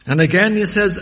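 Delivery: loud, high, ringing sermon voice; full-force pulpit preaching; fiery preaching. Acted. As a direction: proclaim it to the whole congregation.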